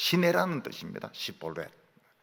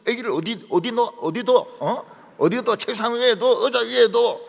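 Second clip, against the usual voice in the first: higher, louder teasing questions; mocking Seoul teasers; 8 semitones higher, 5 dB louder than usual. Seoul people teasing the preacher about his dialect: mocking. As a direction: tease like laughing Seoul folks. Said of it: "막 웃으면서".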